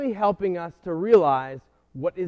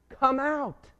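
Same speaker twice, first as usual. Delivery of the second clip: high-pitched call, no added volume; a restrained, imitated yell, pitched up; Jesus calling out; 9 semitones higher than usual. Preacher voices Jesus calling Lazarus from the tomb, restrained high yell. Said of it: "Jesus yells out to Lazarus".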